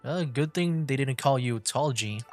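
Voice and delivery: ordinary talk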